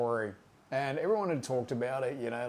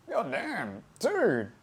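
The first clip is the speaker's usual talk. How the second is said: awed exclamation; 6 semitones higher, 3 dB louder, much slower than usual. Retold or imitated. Watching Pops do two lines.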